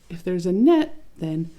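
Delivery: usual speaking voice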